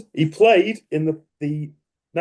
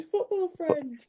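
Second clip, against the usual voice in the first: teasing falsetto; 9 dB quieter, much slower than usual. Pretend. Teasing sing-song repeat of phrase.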